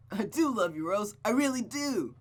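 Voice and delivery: put-on high, loud, giddy boy's voice; lovestruck boy. Smitten boy telling Rose he loves her, high and giddy.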